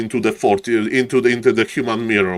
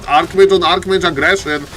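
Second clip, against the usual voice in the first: ordinary talk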